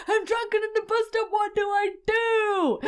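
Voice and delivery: put-on high, panicked drunk cry. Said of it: "and I'm like".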